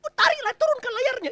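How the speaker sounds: very high, loud frantic orders; panicked yelling. Acted. Disciples yell frantic orders in the storm, high and loud. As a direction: shout like sailors in a storm. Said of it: "saking paniknya".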